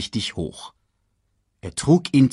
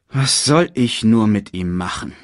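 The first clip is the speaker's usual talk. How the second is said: usual speaking voice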